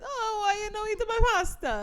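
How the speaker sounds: high hammed-up Italian accent; lightly playing an Italian mamma, Italian accent; Italian accent for a pasta complaint; mock Italian accent. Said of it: "you like to do the accent".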